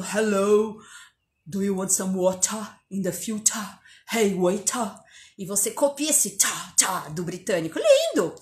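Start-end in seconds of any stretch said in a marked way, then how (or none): 0.0-5.0 lightly put-on British accent, a touch lower. Mimicking a British man ordering, light British accent. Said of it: "você ouve o cara falando". 7.8-8.3 swooning high exclamation; delighted. Real, not acted. Teacher gushing over the British sound, much higher. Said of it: "admiring the British accent".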